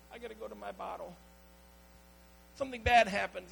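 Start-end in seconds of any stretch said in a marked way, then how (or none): 0.1-1.1 mock whine. Mocking a self-pitying believer.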